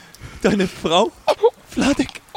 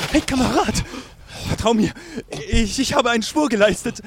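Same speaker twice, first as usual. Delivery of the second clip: normal talking voice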